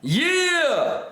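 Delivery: high, hyped cry of joy; excited yell. Real, not acted. Streamer hyping his viewers, high excited yell. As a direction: whoop it up for the chat.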